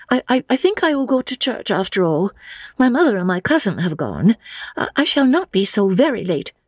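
put-on high, stammering young lady; high-pitched, awkwardly apologetic; flustered young woman. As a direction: back down hastily in a high feminine voice. Narrator voices Lucy's dialogue.

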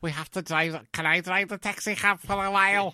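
high, loud Scouse daughter; hammed-up Liverpool accent. Parodying the daughter pestering Dad, broad Liverpool accent. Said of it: "her accent wasn't even that strong".

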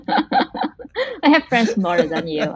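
teasing line through a laugh, slow. Real, not acted. Teasing the interviewer, laughing and slow. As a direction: laugh it out, joking.

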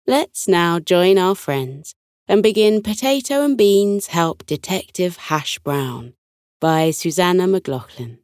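plain reading voice

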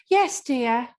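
lightly voiced older mum; a hint of a doting old lady; wishy-washy mum voice. Shown as a demonstration. Improviser demonstrating the mum they play, wishy-washy voice. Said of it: "like that wishy-washy mum voice".

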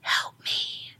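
faint breath-only plea; barely audible retold whisper. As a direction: whisper it as a weak cry for help. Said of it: "in a whispered voice".

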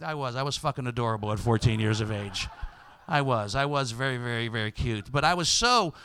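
ordinary talk, nothing marked

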